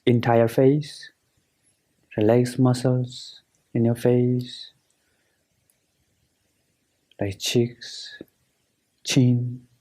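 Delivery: guided meditation. Acted. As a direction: guide them through relaxing, unhurried and measured.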